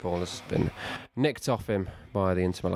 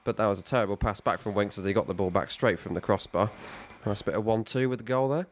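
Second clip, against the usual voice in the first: lively play-by-play commentator voice; mock commentary; 2 semitones higher than usual. Friend plays commentator over the match, live commentary. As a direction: call the match like a TV pundit.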